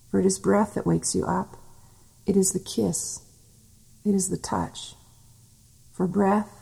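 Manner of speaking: unhurried tender murmur with long pauses; hushed devotional reading. Acted. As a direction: breathe each line gently like a loving presence. Intimate channelled divine voice, softer.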